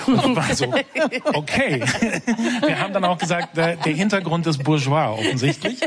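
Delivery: laughing through the words, pitch jumping up; echtes Lachen beim Erzählen. Real, not acted. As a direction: talk while giggling at your own story. Lacht über den zitierten Wiener Fluch. (lachend erzählt, mit echtem Kichern)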